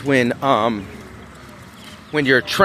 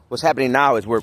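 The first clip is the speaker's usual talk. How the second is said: ordinary delivery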